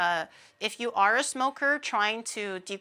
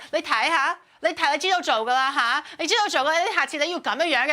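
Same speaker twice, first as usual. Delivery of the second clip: higher, louder, pushy Cantonese coaching; Shadow coaching; 5 semitones higher, 4 dB louder, faster than usual. Retold or imitated. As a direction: egg your friend on, loud and eager. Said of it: "Shadow was like".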